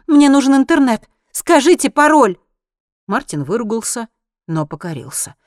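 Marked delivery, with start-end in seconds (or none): 0.1-2.4 high, loud, insistent woman; impatient, raised-voice order; urgent demand. A narrator's reading. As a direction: demand it at full voice.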